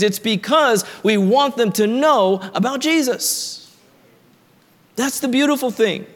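rising, building sermon cadence with repeated hammering phrases; preaching. Acted. Pastor rallying the congregation to evangelism, fervent preaching.

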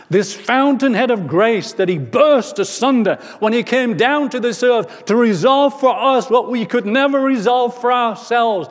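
full-force preacher, high and ringing; impassioned preaching. Real, not acted. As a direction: proclaim it with rising fervour, then settle into scripture. Preaches at full force, quoting Revelation.

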